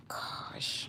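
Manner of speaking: a defeated whisper; whispered gosh. Real, not acted. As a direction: breathe it out under your breath. Sighing over the lost dress.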